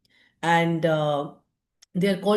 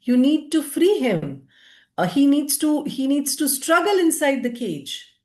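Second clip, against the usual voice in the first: mild mock shout, pitched up; half-raised storyteller's shout; retold shouting; 6 semitones higher than usual. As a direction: call it out higher, like indignant parrots shouting at a hunter. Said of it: "shouting at the hunter".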